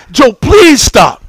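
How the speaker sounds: worn-out dad pleading; desperate retold plea. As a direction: beg a screaming child to stop. Begging the screaming boy to stop.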